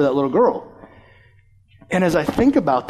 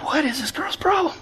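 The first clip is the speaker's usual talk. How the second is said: baffled aside; 10 semitones higher, 5 dB quieter than usual. Retold or imitated. Baffled kids react to girl.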